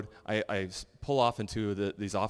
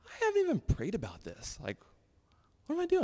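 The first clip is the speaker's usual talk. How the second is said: sudden realization. Retold or imitated. Realizing he forgot to pray.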